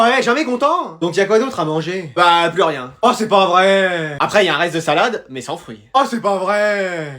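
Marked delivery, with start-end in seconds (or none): 3.0-4.2 mock despair. Despair, nothing left to eat. 5.9-7.1 mock despair. Despair, only salad without fruit.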